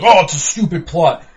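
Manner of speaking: loud whiny mock complaint. Mocking fans who whine about the plot, loud. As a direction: sneer at the fans' gripe. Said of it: "if you people are giving".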